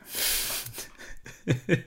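breathy giggle at himself; real chuckle at own bad accent; laugh. Real, not acted. Laughs at his accent attempt.

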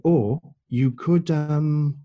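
drawn-out, hesitant suggestion; pondering. Retold or imitated. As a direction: weigh the idea aloud, slowly. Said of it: "he pondered for a moment".

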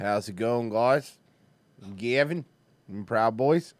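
putting on an Australian greeting; Australian accent. Acted. Host showing off their Australian to the co-host, mock Australian accent. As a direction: try a rough Australian accent. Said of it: "Those are my Australian".

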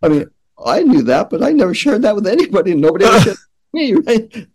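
laughing, rushing out the words in wonder; excited. Real, not acted. Excited recalling rocks can talk.

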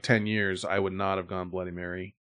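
ordinary delivery

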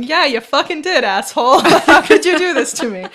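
half-laughing mock rage; mock angry. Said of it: "I wasn't sitting there going".

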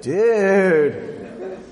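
drawn-out lazy surfer "Dude". Retold or imitated. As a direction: do the laid-back surfer guy. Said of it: "talk like this guy".

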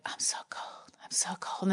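whispered mock dying gasp; dying movie victim. Imitating someone bleeding out in a movie, faint whisper. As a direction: whisper weakly like a movie character fading away. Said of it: "they're like".

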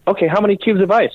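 a hint of a high service voice; bartender. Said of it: "And she was like".

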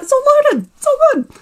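mock the cheery Etsy page saying all is fine; chirpy website voice.

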